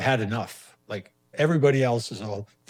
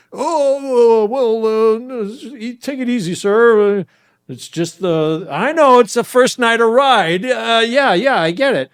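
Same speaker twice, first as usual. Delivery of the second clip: high nervous cop voice; 12 semitones higher, 14 dB louder, slower than usual. Retold or imitated. Cop's nervous apologetic reply voiced.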